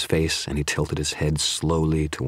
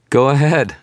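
high, loud cheery dare; breezy raised-voice taunt; cheerful; 10 semitones higher, 8 dB louder than usual. A narrator's reading. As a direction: call it out cheerfully to goad him. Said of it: "I said in a cheerful tone".

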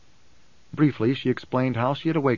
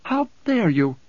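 higher-pitched, indignant outburst; blared outrage; 6 semitones higher, 3 dB louder, slower than usual. A narrator's reading. Alia, stung by fear, rebukes Hayt in a blared outburst. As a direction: blare it out in affronted alarm.

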